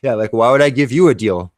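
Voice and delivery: loud scoff of a repeat; loud mocking repeat. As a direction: throw the line back at her, loud and amused. Repeats his line to the customer.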